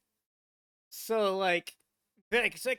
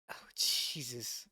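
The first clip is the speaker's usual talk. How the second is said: slow, low, whispered awe; hushed disbelief; 8 semitones lower, 6 dB quieter, slower than usual. Real, not acted. Reactor stunned by the ski journey, hushed disbelief. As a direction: breathe it out softly, stunned. Overwhelmed by the story.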